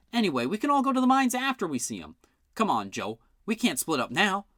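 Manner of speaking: put-on high excited boy voice. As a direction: plead like an eager boy.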